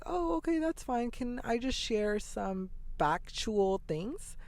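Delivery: imitating a chirpy missionary asking to share facts; sweet missionary girl. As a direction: mimic the girl's polite push to keep talking. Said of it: "And they're like".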